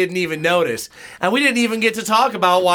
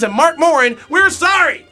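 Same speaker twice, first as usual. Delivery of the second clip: louder and higher, a laughing shouted "We're sorry!"; shouted apology; 8 semitones higher, 4 dB louder than usual. Acted. Ends the apology list shouting.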